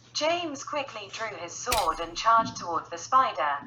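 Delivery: usual speaking voice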